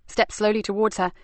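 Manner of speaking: ordinary delivery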